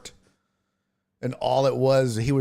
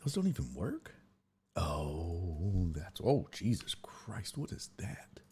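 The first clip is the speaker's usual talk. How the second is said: slow whispered Jesus Christ; whispered exasperation; 7 semitones lower, 12 dB quieter, slower than usual. Real, not acted. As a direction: breathe it out in dismay. Graphics not working on screen.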